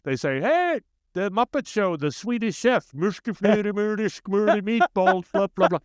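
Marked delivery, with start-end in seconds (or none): 0.4-3.7 much higher, hammed-up Swedish Chef gibberish accent; sing-song fake Swedish like the Muppet chef; cartoonish mock Swedish accent, pitched way up. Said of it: "That's not a Swedish accent". 3.7-5.8 much higher, hammed-up Swedish Chef gibberish accent; sing-song fake Swedish like the Muppet chef; cartoonish mock Swedish accent, pitched way up. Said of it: "That's not a Swedish accent".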